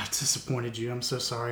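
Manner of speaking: soft, sighing imitation of a disappointed student; quiet mock dejection; retold dejected apology. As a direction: sigh and apologize, crestfallen. Retold upset student apologizing after loss.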